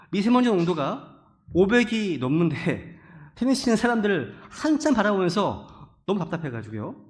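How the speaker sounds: ordinary delivery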